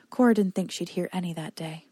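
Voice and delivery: usual speaking voice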